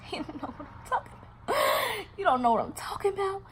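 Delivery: high, laughing disbelief; incredulous high squeal. Retold or imitated. Hurt woman echoes the rival's claim, squeaky and incredulous. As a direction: squeal it back as a mocking question.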